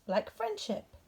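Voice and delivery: high, sweet bear voice; lightly voiced hopeful bear. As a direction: offer the guess hopefully.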